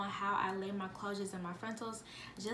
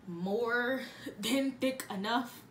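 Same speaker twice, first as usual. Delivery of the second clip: laughing; 3 semitones higher, much slower than usual. Real, not acted. Laughing at how thick it is.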